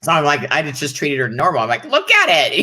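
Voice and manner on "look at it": squeaky high, punchy little line; high mock exclaim. Guest imitating herself to the hosts, high squeak. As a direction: pop the voice way up, louder, like a showy aside.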